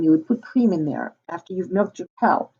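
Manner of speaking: plain reading voice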